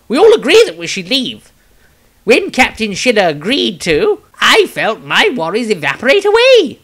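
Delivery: put-on a worried young wizard declaiming his entry; Maestro's voice. Maestro's diary read in character.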